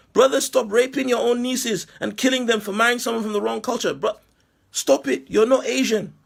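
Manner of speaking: wag a finger at the whole community; mock preacher scolding. Imagined leader scolding the brothers, mock preacher tone. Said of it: "If somebody came out and said".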